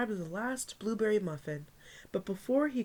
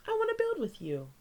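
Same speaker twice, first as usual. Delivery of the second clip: put-on high, childlike plea; high little-girl voice; little sister voice; 13 semitones higher than usual. A narrator's reading. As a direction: voice the small sister asking sweetly. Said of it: "she said".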